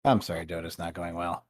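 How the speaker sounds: low, soft mock apology; imagined apology. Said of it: "I can picture how you said it".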